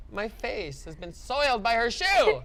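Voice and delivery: slow, grandly tragic lament; hammed-up melodrama, drawn out; mock tragic. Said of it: "And you're like".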